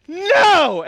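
very high, very loud tantrum yell; full-volume mock toddler shriek; imitated toddler scream. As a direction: scream a refusal like an outraged two-year-old. Toddler screams refusal after dunking.

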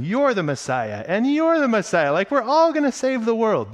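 high, loud, silly Jesus handing out titles to everyone; mock it as the wrong idea of Jesus; goofy mock Jesus. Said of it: "Jesus is walking around going".

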